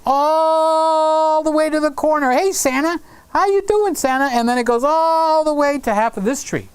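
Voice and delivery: playful high singsong; sing-song. Acted. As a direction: swoop the voice up like a showman. Showing the camera sweep, greeting Santa.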